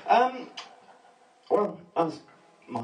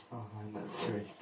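soft, slow, lower cooing; gentle mock aww; cooing women; 5 semitones lower, 10 dB quieter than usual. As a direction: sigh it like it's adorable.

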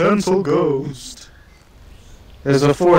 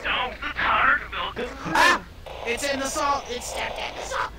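panicked screaming; 8 semitones higher, 3 dB quieter than usual. Real, not acted. Ghost attacking, panic.

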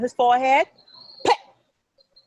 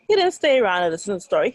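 ordinary delivery, nothing marked

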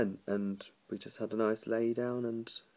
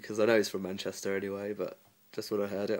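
ordinary delivery

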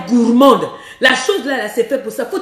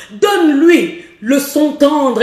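tender sound; much slower than usual. Shown as a demonstration. Coach demonstrating the tender voice to wives, slow. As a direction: say "Donne-lui le son tendre" slowly, coaxing. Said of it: "demonstrating tender sound".

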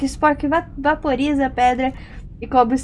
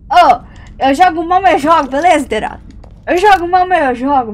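louder, higher cheeky brag; loud playful boast; 5 semitones higher, 8 dB louder than usual. Real, not acted. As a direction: shout it out proudly. (bravata alta e brincalhona)

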